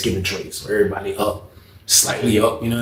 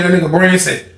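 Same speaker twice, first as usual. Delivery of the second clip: ordinary talk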